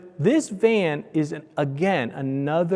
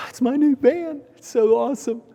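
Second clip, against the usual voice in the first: much higher, giddy proud owner; excited boast; 10 semitones higher than usual. Retold or imitated. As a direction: show off a new toy with high-pitched glee. Showing family his new van.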